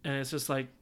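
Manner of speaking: ordinary delivery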